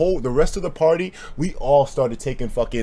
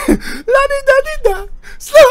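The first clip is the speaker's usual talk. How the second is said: laughing singsong; 5 dB louder, slower than usual. Real, not acted.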